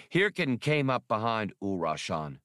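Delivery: plain reading voice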